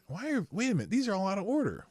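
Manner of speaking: high, startled confusion; suddenly confused, voice jumping up high; wait, what is this; baffled surprise. Real, not acted. Finds bookmarks out of order.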